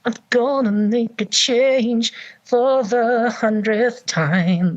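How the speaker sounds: high, slow put-on character voice; ventriloquist character voice. Shown as a demonstration. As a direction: show off a voice without moving lips. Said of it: "the different voices without moving my lips".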